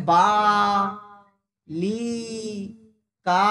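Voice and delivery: বা, লি, কা each held long on its vowel; drawn-out open syllables. Shown as a demonstration. Teacher proving to pupils the breath is unblocked, vowels held long. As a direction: stretch the vowels of বা, লি, কা, pausing between. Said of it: "আমরা উচ্চারণ করে নিয়ে যেতে পারছি".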